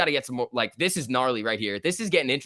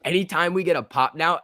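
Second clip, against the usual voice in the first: ordinary talk, nothing marked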